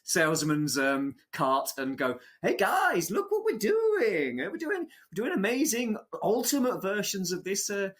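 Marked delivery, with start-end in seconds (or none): 2.4-7.9 high-pitched hammy sales pitch; snake oil salesman. Retold or imitated. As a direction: pitch it like a huckster hyping pre-orders. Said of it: "in their snake oil salesman's cart".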